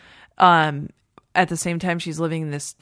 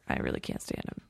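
whispered aside; 13 dB quieter, faster than usual. Real, not acted. Aside about a bad actor.

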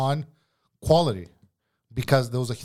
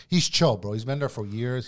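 normal talking voice

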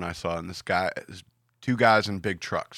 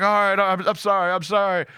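high-pitched imitated apology; apologetic driver; 9 semitones higher, 4 dB louder than usual. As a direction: give in, apologizing on repeat. Acts out repeated apology.

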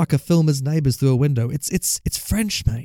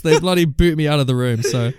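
ordinary talk

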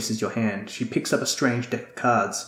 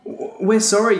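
nervous apology; 10 semitones higher, 3 dB louder than usual. Acted. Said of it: "hoping you appear sorry and not just scared".

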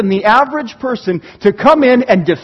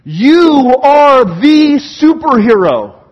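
loud, high, slow rallying plea; crying out; 5 semitones higher, 6 dB louder than usual. Acted. Preacher as David calling God to battle, crying out. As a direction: call up to God with drawn-out, forceful words. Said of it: "David is crying out like this".

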